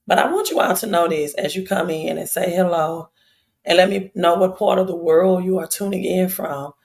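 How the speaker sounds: ordinary talk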